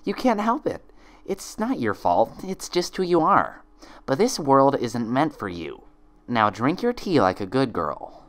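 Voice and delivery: high, sweetly poisonous twin voice; high sly double coaxing. Acted. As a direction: lull her with sinister sweetness.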